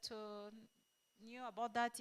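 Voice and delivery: usual speaking voice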